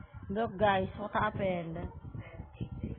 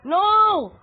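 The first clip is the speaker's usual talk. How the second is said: very high, loud scream of fright; real startled scream; panicked scream; 12 semitones higher, 14 dB louder than usual. Real, not acted. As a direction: yell as Granny appears. Granny appears, jump scare.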